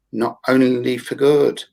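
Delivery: usual speaking voice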